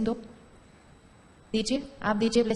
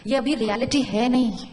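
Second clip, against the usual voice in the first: ordinary delivery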